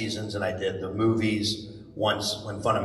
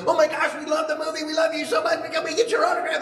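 high-pitched gushing fan imitation; fans freaking out; faster than usual. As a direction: gush in a squealing, hurried rush. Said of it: "freaks out and crowds around".

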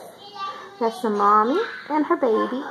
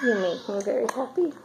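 normal talking voice

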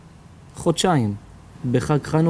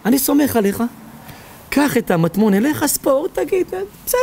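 excited pleading; 10 semitones higher than usual. Retold or imitated. Pressing the treasure on him.

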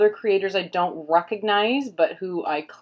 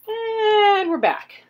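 high, drawn-out sing-song "we're back"; sing-song announcement; 5 dB louder, much slower than usual. Real, not acted. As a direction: announce your return like a show host. Returning to camera after break.

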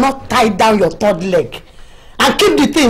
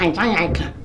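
mock sobbing imitation, lower and softer; mock crying; 7 semitones lower, 7 dB quieter than usual. Wife mocks her husband's excuse with a fake crying whimper.

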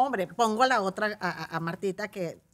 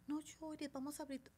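soft, higher comforting voice; soft coaxing; 6 semitones higher, 16 dB quieter than usual. Shown as a demonstration. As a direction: soothe a crying child. Consolando a un niño. (voz suave para consolar al niño)

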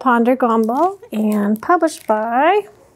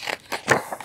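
sharp surprised gasp; 9 dB quieter than usual. Acted. Doorbell about to ring.

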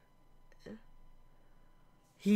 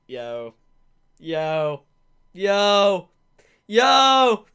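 dawning shock; 5 semitones lower, 3 dB louder, much slower than usual. Real, not acted. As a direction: repeat it as the shock grows. Shock sinking in.

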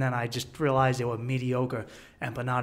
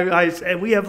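ordinary talk, nothing marked

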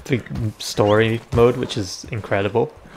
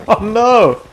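high, loud laughing oh no; real dismay; 10 semitones higher, 7 dB louder than usual. Real, not acted. Player reacting to his horse mishap, loud amused dismay. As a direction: cry out in amused horror.

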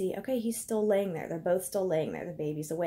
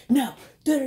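higher, louder mock blabbing; mocking his rant; 6 semitones higher, 9 dB louder, faster than usual. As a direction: parody his ranting as nonsense. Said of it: "He wanted to stand there like".

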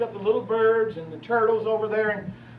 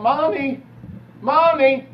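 rising, louder mock child's cry; child calling mommy; 4 semitones higher, 3 dB louder, slower than usual. Boy calls after his departing mother, pleading. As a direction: plead after the car like a lost little boy. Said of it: "I'm screaming my head off as a kid".

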